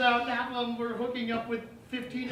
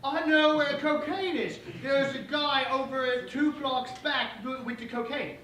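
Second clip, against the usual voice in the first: slow, higher-pitched mock British accent; terrible British accent; 4 semitones higher, slower than usual. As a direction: do an awful, drawn-out British accent. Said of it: "in, like, the worst British accent".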